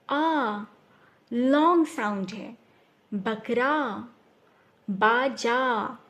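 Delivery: राजा with the final आ drawn out; stretching the long aa. Shown as a demonstration. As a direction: draw out the end of राजा, बकरा, बाजा. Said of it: "sound long होगी". (आख़िरी आ को लंबा खींचकर)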